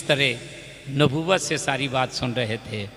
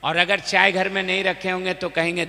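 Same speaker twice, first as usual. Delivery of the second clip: usual speaking voice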